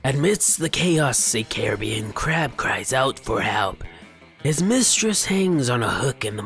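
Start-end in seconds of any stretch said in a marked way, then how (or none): none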